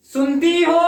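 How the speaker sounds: drawn-out call. Shown as a demonstration. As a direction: call out to someone far off, stretching the vowel. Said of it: "हमने बोला".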